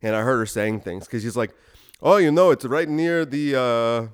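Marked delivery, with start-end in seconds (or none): 2.0-4.1 higher, louder imitation Indian accent; put-on Indian accent, opening high and loud; rough Indian accent. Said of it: "am I doing an Indian accent?".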